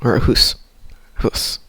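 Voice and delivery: Canadian "hoose" for "house"; mock Canadian pronunciation; Canadian accent. Shown as a demonstration. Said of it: "Like the Canadian".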